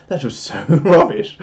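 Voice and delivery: real laugh breaking into the words; slow, laughing through the verdict; laughing self-mockery. Real, not acted. Streamer judging own acting as rubbish, laughing.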